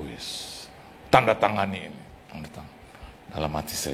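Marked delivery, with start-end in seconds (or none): none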